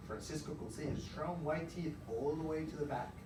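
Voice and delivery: ordinary talk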